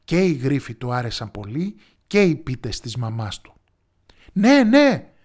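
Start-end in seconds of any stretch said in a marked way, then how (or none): 4.3-5.1 put-on much higher and louder, eager boy; excited shout. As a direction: yell it with delight. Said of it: "φώναξε". (ενθουσιασμένη φωνή)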